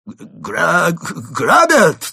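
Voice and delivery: high, loud shout with a broken start; halting alarm call; stammered cry. A narrator's reading. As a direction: stumble into a yell for help. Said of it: "выдавил из себя Крот".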